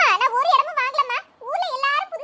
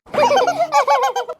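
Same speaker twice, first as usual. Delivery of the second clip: loud burst of laughter; 7 dB louder than usual. Acted. Laughing at bald-head joke.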